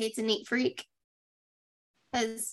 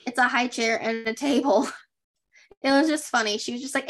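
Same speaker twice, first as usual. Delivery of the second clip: normal talking voice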